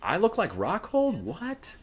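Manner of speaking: very high, drawn-out squeal of disbelief; flattered high-pitched disbelief; incredulous delight. Real, not acted. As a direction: shoot up into falsetto, astonished at the compliment. Reacts to viewer's surprising compliment.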